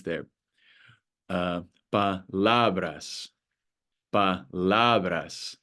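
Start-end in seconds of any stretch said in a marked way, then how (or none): 1.9-3.3 slow "palabras", stress on the middle LA; stress on second-to-last syllable. Shown as a demonstration. Teacher showing learners the rule, slow "palabras" stressed on LA. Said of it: "falls on the second to last syllable". 4.1-5.6 "palabras" slowly, weight on LA; stress on second-to-last syllable. Shown as a demonstration. Teacher repeating the example for learners, slow "palabras" with LA stressed. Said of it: "falls on the second to last syllable".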